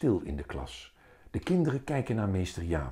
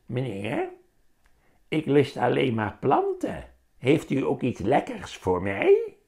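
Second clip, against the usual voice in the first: higher, spooky plant-eater voice; scary voice; 5 semitones higher, 3 dB louder than usual. A narrator's reading. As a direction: play a creepy customer for the kids. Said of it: "vraagt met een enge stem". (enge stem, hoger en griezelig)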